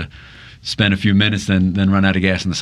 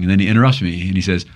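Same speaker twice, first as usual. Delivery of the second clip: ordinary talk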